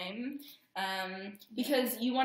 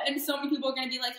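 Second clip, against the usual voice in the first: ordinary talk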